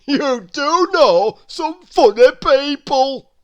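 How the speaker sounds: a jolly plump man amused; Mister Greedy. A narrator's reading. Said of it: "chuckled Mister Greedy".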